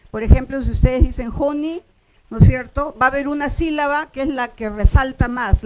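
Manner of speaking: ordinary talk, nothing marked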